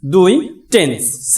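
slow, each part apart; split into parts. Shown as a demonstration. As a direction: lay out "sense" and "tense" slowly. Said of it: "দুটি অংশ পাই".

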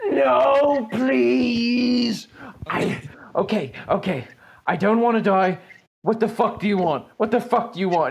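high, pleading panic at the start, settling into frightened demands; begging for life. Acted. Cultist on his knees begging the player not to kill him. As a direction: beg like a man who doesn't want to die. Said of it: "he says".

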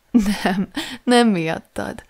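slow reply with a catch of laughter; half laugh, half hiccuping sob; sobbing laugh. A narrator's reading. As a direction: say it through a small choked laugh. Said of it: "csuklott fel a nevetés, mintha hüppögés lett volna".

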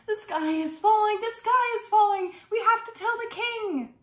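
high, loud mock-alarm chant; panicked shouting. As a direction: shout it out like frantic storybook chickens. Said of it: "they went down the road shouting".

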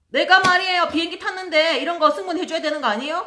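loud, high screaming complaint; shrieking mother. Retold or imitated. Mother screaming at the flight attendant, loud high shout. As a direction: scream at the crew like an entitled mother. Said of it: "소리를 막 지르는 거예요".

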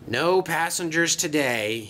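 usual speaking voice

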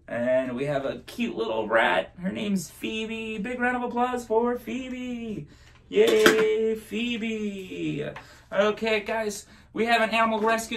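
high, bouncy magic show voice; kids party magician. Acted. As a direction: hype up the trick for kids. Presents rat to audience.

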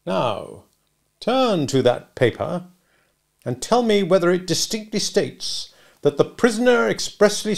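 lawyer's weighty instruction; bullying cross-examiner. Acted. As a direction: spell it out for the witness. Stranger cross-examining Mister Wopsle.

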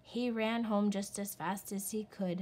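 ordinary delivery

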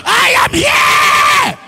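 loud, high, stretched shout. Shown as a demonstration. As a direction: announce yourself at full voice. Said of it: "shout out".

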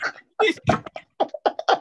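high, loud burst of laughing; real high-pitched cackling laugh; helpless laughter. Real, not acted. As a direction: crack up mid-story. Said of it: "Why am I laughing".

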